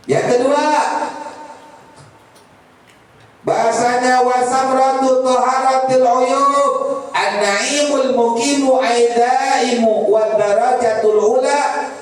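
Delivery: slow, high, sustained recitation; preaching recitation. Acted. Preacher reciting the second fruit to the congregation, preaching recitation. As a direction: intone the Arabic slowly on a held pitch.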